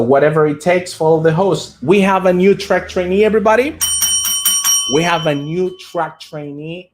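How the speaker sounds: rally everybody with the news; excited announcement. Real, not acted. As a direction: stream host hyping a new follower. Announcing a new follower.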